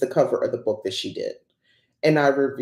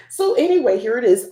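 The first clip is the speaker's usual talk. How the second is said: much higher and louder burst, falling at the end; excited reveal; 9 semitones higher, 6 dB louder than usual. Real, not acted. Vlogger revealing her new oracle deck to viewers, excited high burst. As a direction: pop up bright and loud as you show it off.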